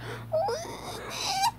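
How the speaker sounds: very high whimpering gasp; a sob-like intake of breath; crying gasp. Retold or imitated. Thomas about to burst into tears, high whimper. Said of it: "He's like".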